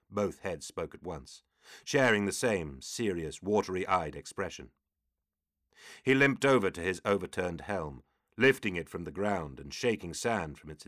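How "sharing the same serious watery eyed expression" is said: ordinary delivery, nothing marked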